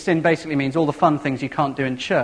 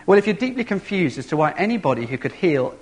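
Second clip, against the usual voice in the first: normal talking voice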